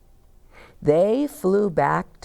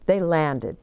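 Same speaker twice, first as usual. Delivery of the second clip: usual speaking voice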